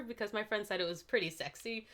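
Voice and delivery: usual speaking voice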